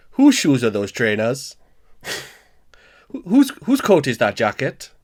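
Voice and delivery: lightly put-on Welsh lilt; Welsh accent. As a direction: ease into a Welsh accent with a practice phrase. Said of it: "use the Jimmy Carr technique to try and get into it".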